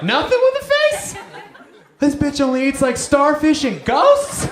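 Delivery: very high, loud disbelieving squeal; hammed-up childish disbelief; shocked kid. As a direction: squeal in horrified confusion. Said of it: "kid shocked".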